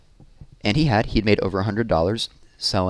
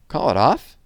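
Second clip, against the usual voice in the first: surprised; 6 semitones higher, 6 dB louder than usual. A narrator's reading. Sister surprised in story.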